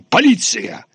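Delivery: high, frantic yell; furious shout. A narrator's reading. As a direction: shout it out in a rage. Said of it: "закричал он бешеным голосом".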